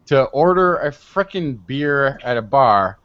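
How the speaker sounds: ordinary talk, nothing marked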